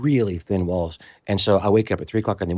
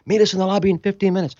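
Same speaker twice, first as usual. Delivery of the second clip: higher, quick barked order; soldier's order; 10 semitones higher, 3 dB louder, faster than usual. Retold or imitated. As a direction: rattle off the command. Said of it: "soldier's order".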